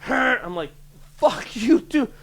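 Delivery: high, loud snarl at the tailgater; angry yell. Retold or imitated. Driver yelling at the guy behind him, angry. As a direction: bite his head off.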